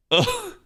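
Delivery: high laugh and groan. Real, not acted. Laughing at own joke.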